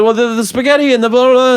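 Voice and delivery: fast, high mock babble; rambling Barry. Barry brushing off the press, fast high mock babble. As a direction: gabble a flustered non-answer, high and quick. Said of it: "And he said".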